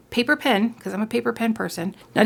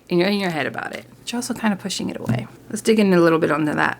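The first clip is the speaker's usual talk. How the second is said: ordinary talk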